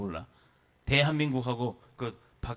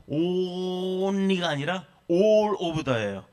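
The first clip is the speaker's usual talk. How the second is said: slow emphasis; 5 semitones higher, much slower than usual. Acted.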